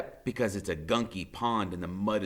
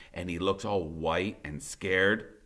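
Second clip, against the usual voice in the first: plain reading voice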